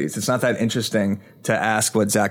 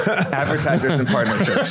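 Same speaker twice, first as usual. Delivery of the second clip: laughing; 5 semitones higher, slower than usual. Real, not acted. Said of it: "repeats his answer laughing".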